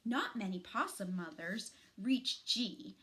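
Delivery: ordinary delivery, nothing marked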